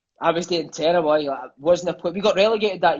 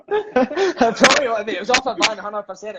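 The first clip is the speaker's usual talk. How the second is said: high, drawn-out laughing reply; real laugh running through the word; 4 semitones higher than usual. Real, not acted. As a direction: laugh while answering. Laughs at the Del Boy joke.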